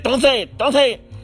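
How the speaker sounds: high, urgent mock plea; pleading King. Retelling the King begging Austin not to say it, high urgent plea. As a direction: beg him not to finish the line. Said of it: "the King said".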